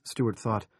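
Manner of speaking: plain reading voice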